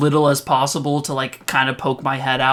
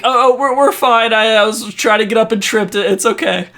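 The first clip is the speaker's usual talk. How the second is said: nervous reassurance; 6 semitones higher, 5 dB louder than usual. Acted. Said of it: "I'm like".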